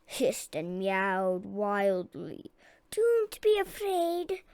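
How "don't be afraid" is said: much higher, slow squeaky reassurance; nervous mouse. A narrator's reading. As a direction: soothe the crowd in a high mouse voice. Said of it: "Doctor Varmint told the crowd".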